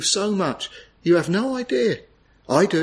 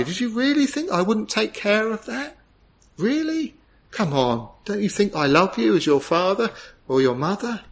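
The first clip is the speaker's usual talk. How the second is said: kind father; 6 semitones higher than usual. Retold or imitated. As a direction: gently reassure a worried child. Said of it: "And you say to them kindly".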